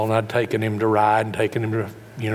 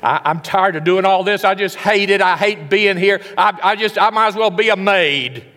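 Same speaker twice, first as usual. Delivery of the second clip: higher, louder fed-up whine; resentful griping, voice raised; complaining wife; 7 semitones higher, 6 dB louder than usual. Retold or imitated. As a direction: vent like a worn-out wife. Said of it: "You got a wife that says".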